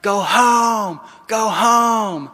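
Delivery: high, drawn-out shout of a mob; imitated yelling. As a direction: call it out like a jeering crowd. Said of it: "they're yelling".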